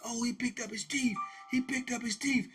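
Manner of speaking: high-pitched crowd exclamation; imitated shocked onlookers.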